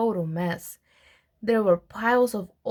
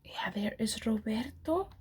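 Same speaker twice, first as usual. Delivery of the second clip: whispered aside; 9 dB quieter than usual. Real, not acted. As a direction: lean in and whisper, pointing at the picture. Aside to listener, picture.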